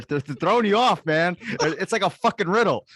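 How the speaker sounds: high loud frustrated rant; exasperated outburst. Real, not acted. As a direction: throw up your hands at it.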